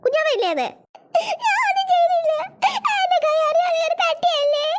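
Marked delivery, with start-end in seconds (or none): 1.4-4.7 high-pitched, drawn-out bawling; tearful wailing. Acted. Child blamed for bumping the baby, crying wail. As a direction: wail the excuse through tears, stretching the end. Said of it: "കരച്ചിൽ നിർത്തിയേ".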